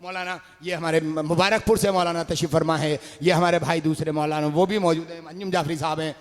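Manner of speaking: ordinary delivery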